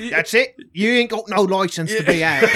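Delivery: loud, high, bossy mock officer; hammed-up policeman laying down the law; mock cop voice.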